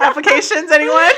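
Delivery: high, loud playful hawking; mock announcement with a laugh in it; joking call-out. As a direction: call out to the room, amused. Jokingly calling out for applicants.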